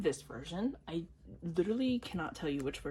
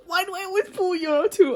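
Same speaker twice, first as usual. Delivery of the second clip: much higher and louder, exasperated; exasperated outcry; 11 semitones higher, 12 dB louder than usual. Real, not acted. Fan pulls the same member again, loud exasperated cry. Frustrated at the repeat pull.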